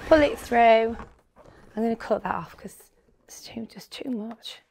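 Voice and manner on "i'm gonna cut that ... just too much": hushed aside while working; whispering. Real, not acted. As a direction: whisper it under your breath.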